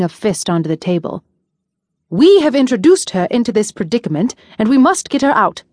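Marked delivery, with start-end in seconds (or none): 2.1-5.6 louder, rising, heated; heated insistence. A narrator's reading. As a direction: pound the point home with force. Ari pressing the prince, fist slammed.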